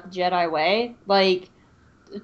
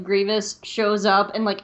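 ordinary talk

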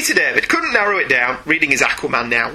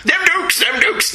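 high-pitched mock Southern accent; Southern accent attempt; 13 semitones higher, slower than usual. Shown as a demonstration. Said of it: "so that I can get the accent going".

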